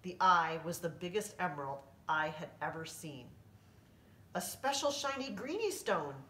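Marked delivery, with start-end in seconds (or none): 4.3-6.2 higher, talking through a mouthful; mouth full. A narrator's reading. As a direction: speak round a rope in the mouth. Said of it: "with his mouth full of rope".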